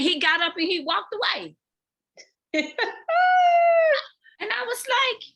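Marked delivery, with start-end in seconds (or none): 2.5-4.1 a real laugh that breaks out high. Real, not acted. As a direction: burst of high, loud laughter. Laughs recalling the moment.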